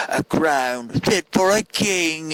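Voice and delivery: high, drawn-out surly soldier in a mock English accent; surly English-sounding accent. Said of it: "Roman soldiers this surly".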